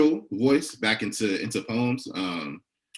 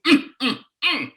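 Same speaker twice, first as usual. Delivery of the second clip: savouring hum; 6 dB louder than usual. Acted. Savouring food in poem.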